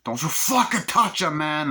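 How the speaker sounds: high, loud warning; an imitated furious threat; protective threat. Tony threatening over his sister.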